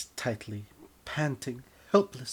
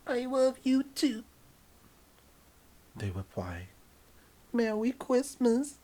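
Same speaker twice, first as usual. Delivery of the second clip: sweet lover; 14 semitones higher than usual. Acted. The lover replying tenderly in a high falsetto. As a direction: answer back sweetly in the lover voice. Partner voice, gentler.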